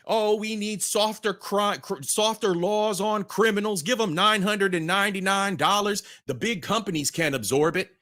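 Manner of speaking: mock whiny liberal. Mocking liberal view.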